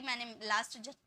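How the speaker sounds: usual speaking voice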